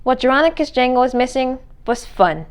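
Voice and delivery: ordinary delivery, nothing marked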